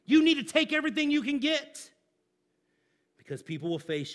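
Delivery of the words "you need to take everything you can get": mock scoffing. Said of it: "they're gonna like".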